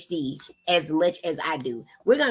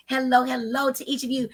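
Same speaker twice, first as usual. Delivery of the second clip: usual speaking voice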